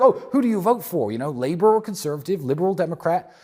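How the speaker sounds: imitating people asking who he votes for, pitched high and loud; mock questioner. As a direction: ape the pestering questions of everyone. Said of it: "everyone's like".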